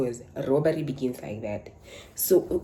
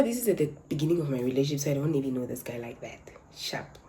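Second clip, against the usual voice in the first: normal talking voice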